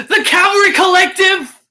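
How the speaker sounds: high, loud cheering call of the name; excited shout-out. Real, not acted. Streamer shouting out a commenter, loud and high. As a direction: announce the name like a hype man.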